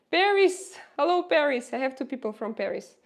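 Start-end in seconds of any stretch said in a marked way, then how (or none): 0.1-1.7 loud, high call out to viewers; excited greeting. Real, not acted. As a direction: hail them with delight.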